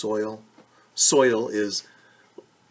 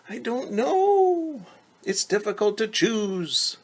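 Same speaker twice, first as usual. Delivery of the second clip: put-on very high, slow and stretched, a girl overwhelmed; weary little girl; 11 semitones higher, 3 dB louder than usual. Shown as a demonstration. Teacher acting Rosa's sigh for the class. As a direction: act out Rosa unable to choose. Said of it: "That's what sighing means".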